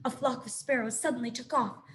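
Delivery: ordinary delivery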